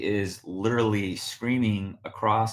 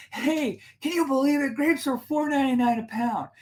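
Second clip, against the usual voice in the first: high, pitched-up shout of the indignant woman; mock yell across the store. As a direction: call out to her husband across the aisles, pitched high. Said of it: "she starts yelling".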